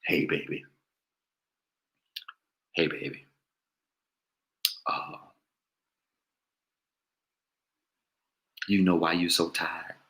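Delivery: low, hushed, breathy crooner purr. Retold or imitated. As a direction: seduce her with a low, husky croon.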